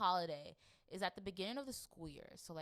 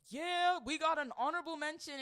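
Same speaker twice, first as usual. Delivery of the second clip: mock cheer; 8 semitones higher, 6 dB louder than usual.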